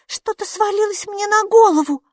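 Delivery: terrified little character, pitch way up; horrified babbling. A narrator's reading. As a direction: squeak it out in horror. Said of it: "в ужасе пролепетала Минни".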